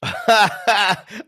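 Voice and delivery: sudden loud burst of laughter. Real, not acted. Laughing at chat.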